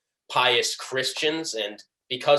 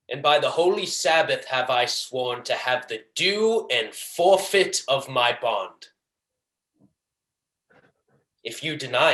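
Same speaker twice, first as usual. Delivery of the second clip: Shylock. Acted. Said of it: "hatred and pleasure that I tried to deliver this monologue".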